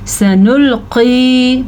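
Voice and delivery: सनुल्की, short sa and drawn-out qee; heavy qaf, madd. Shown as a demonstration. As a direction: recite सनुल्की slowly, stretching the ending. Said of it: "मोटे क़ाफ़ का ध्यान रखना".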